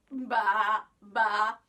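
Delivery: loud bleating sheep. Acted. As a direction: bleat it out for the kids. Sheep noises in children's story.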